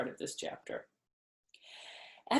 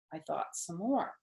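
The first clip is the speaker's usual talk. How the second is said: usual speaking voice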